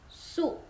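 "Su" high and unvoiced-looking; no final s; French sous; silent s. Shown as a demonstration. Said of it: "S tidak dibaca".

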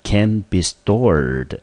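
"Can be stored" said very slowly; slow reading. Shown as a demonstration. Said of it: "teacher reading example slowly".

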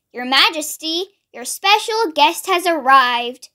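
plain reading voice

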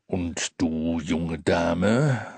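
deep Santa voice. A narrator's reading. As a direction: speak down kindly. Santa addresses girl.

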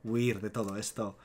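softly, voicing her plea; low, quiet retelling of her words; retold tender confession. She speaks to him as he seems asleep, retold quiet confession. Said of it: "ella le dice".

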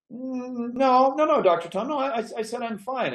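lightly voiced old lady, firmly declining; elderly Betty voice. Betty voiced in retold call.

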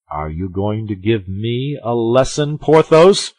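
mild anger flaring on the name; bristle, rising to a loud final word; affronted cry. A narrator's reading. Aramis resents being lectured by Porthos, affronted cry. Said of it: "cried Aramis, from whose usually mild eye".

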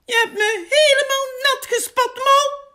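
very high, loud wail of outrage; angry squeal. A narrator's reading. Big scolds Mol for soaking him, angry shrill wail. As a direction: wail indignantly at the culprit. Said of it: "hield Big boos".

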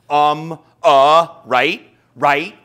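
loud fillers begging for agreement; validation-seeking filler words; mock filler words. Shown as a demonstration. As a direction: hammer out needy filler words. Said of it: "an artificial attempt at trying to get validation".

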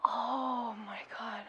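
slow, hushed disbelief; stunned whisper. Real, not acted. Viewer stunned by a near miss in the match, whispering. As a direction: breathe it out slowly in a whisper. Stunned after a tense play.

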